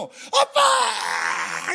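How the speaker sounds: a long, high wailing cry of "Dad"; a child sobbing out for his father; high drawn-out tearful shout. Acted. Child runs to father crying.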